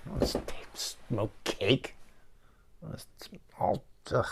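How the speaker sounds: breathy under-the-breath whisper; a quick whispered gripe; whispered aside. Real, not acted. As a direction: mutter it to yourself in a whisper.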